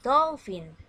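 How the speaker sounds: dolphin called out loud for the class; teacher shouting a vocabulary word out; loud model word. Shown as a demonstration. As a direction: call out dolphin loudly for children to echo. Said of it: "listen and repeat after me".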